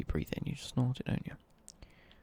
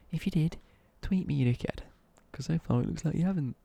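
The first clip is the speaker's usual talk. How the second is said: ordinary talk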